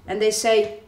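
ordinary talk, nothing marked

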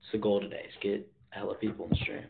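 ordinary talk

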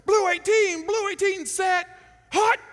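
high loud cadence; loud quarterback call; shouted snap count. Retold or imitated. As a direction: bark the count to the line. Calling the snap count again.

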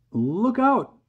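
high, loud shout of warning; crying out. A narrator's reading. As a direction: yell an urgent alarm. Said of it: "James cried".

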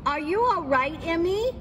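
lightly voiced boy asking if she's okay; small boy calling. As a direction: call up to her like a worried kid. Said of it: "He called out".

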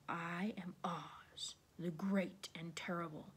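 hushed, slow, near-whispered proclamation; quiet, menacingly measured announcement; low, quiet voice. A narrator's reading. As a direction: speak softly and slowly from the fire. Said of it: "quiet voice came from the ball of fire".

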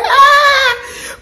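high laughing squeal; laughing shriek. Real, not acted. Vlogger cracking up at her own joke, shrieking laugh.

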